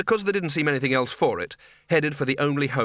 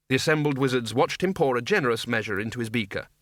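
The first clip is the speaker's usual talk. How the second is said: plain reading voice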